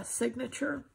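ordinary talk, nothing marked

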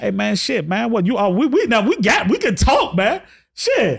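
high, excited rush; light up at the new topic; real excitement, keeping the talk going. Real, not acted. Host excited, conversation reopens.

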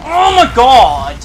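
startled shout. Real, not acted. Shot mid-sentence in fight.

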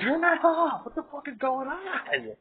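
high loud yell of alarm, then a slow outraged question. Real, not acted. To the dog wrecking the gear, a high alarmed yell. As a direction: shout high and sharp, then drag out the question. Yelling at the dog's mess.

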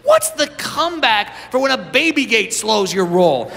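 normal talking voice

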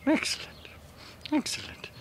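whispered approval; hushed praise. Real, not acted. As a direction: whisper it softly to the animal. Quietly praising the pet.

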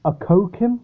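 Okokim said as uh-KOH-kim; unsure Okokim, short first vowel, long koh; alternative pronunciation. Shown as a demonstration. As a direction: try Okokim in three syllables, questioning. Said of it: "not sure how this is pronounced".

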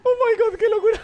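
high, giggly amazement; laughing disbelief. Real, not acted. Gamer amazed at the madness, high laughing disbelief. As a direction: squeak it out through laughter.

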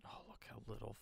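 breathy whispered aside. Real, not acted. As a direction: whisper it, delighted at the bargain. Quiet aside about cheap contract.

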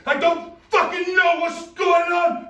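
screaming in blind panic; panicked scream. Acted. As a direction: yell it, terrified and lost.

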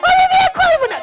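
tearful refusal. Acted. Bride refuses again.

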